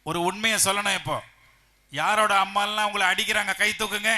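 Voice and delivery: loud, high question to the crowd; high-pitched rallying shout. Real, not acted. Calling children for a show of hands.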